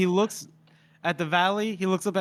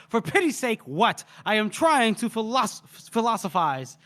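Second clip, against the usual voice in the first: higher-pitched, hammed-up impatience; retold exasperation; 5 semitones higher than usual. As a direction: act put out at being interrupted. Retold general irritated at being interrupted.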